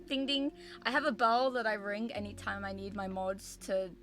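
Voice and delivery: ordinary delivery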